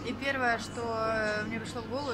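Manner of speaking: ordinary talk, nothing marked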